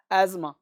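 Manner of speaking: अस्थमा as "az-ma"; silent TH, Z sound. Shown as a demonstration. Teacher lands on the correct form for learners, अस्थमा as "azma". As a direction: soften S to Z in अस्थमा, no "tha". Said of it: "हल्का सा ज का sound देना".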